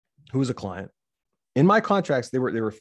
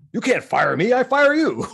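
high, louder, laughing mock outrage; mock indignant client; 13 semitones higher, 5 dB louder than usual. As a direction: play the shocked client, laughing at her.

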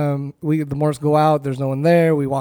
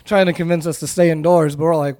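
ordinary talk

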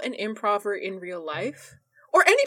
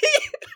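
high laugh; 3 dB louder than usual. Real, not acted. Laughing at the insult.

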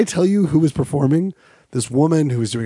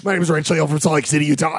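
rushed mock intro; 4 semitones higher, faster than usual. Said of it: "retold mic moment, faster".

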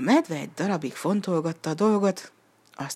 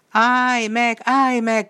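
high, loud commanding shout; shouting after; 7 semitones higher, 7 dB louder, much slower than usual. A narrator's reading. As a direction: call out to stop the horse. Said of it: "kiáltott utána a fiú". (hangos, magas kiáltás a ló után)

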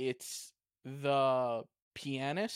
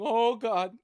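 higher, louder mock groan of disbelief; Ric Flair; 7 semitones higher, 5 dB louder than usual. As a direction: imitate a wrestler's shocked "oh God". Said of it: "you can hear Ric Flair going".

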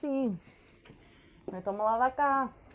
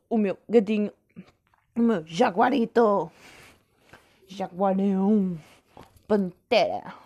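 talking to a cat; 2 semitones lower, 4 dB louder, slower than usual. Real, not acted. Petting cat, calls it names.

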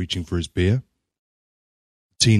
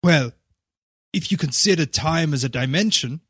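hesitant; 10 semitones higher than usual. A narrator's reading. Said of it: "Will said hesitantly".

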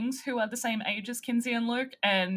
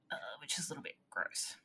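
whispered aside; 5 semitones lower, 14 dB quieter, faster than usual. Real, not acted. Aside after murder description.

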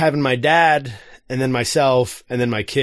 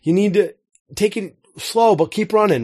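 higher-pitched call out to a runner ahead; retold yell; 8 semitones higher than usual. Dad calls to his daughter up ahead, retold yell. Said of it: "I yelled to her".

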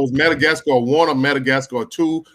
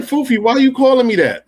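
much higher and louder, baffled; incredulous; 11 semitones higher, 5 dB louder than usual. Retold or imitated. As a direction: demand it in disbelief. His own baffled reaction.

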